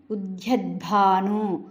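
ఉద్యద్భాను slowly, with a long దా and an aspirated భా; slow careful ఉద్యద్భాను with a long da and a breathy bhaa. Shown as a demonstration. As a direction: draw out ఉద్యద్భాను, dwelling on the aspirated భా. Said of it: "మళ్ళీ భా వత్తుంది".

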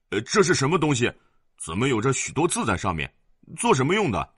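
put-on a brash little boy asking fast; child's question. Child Wang Gui asks.

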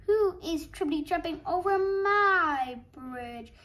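loud roaring storybook troll; big, booming troll challenge; troll's roar. A narrator's reading. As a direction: roar it out, swelling on MY. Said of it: "he roared".

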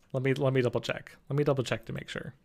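ordinary talk

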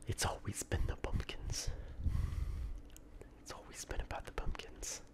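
hushed, conspiratorial whisper; mock whisper. Player reveals it was the pumpkins, mock whisper. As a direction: whisper it like a silly great revelation.

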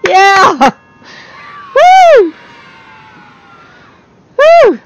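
high, loud triumphant whoops; excited whoops. Real, not acted. Player surviving the night, whooping excitedly. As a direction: celebrate at the top of your lungs. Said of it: "not that I'm excited".

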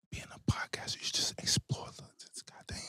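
whispered aside; whispering. Real, not acted. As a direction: drop to a whisper. Aside under his breath.

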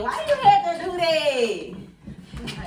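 slow, drawn-out laughing complaint. Real, not acted. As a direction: laugh while you ask it. Laughing, playfully accusing the snack.

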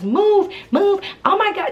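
high-pitched mimicked fluster; mock flustered protest. Staircase telling her friend to move, high mock fluster.

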